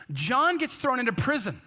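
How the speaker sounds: ordinary delivery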